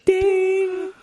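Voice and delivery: high ding. Pretend. Mimicking a bell ding.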